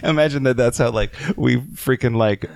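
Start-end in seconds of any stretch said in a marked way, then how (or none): none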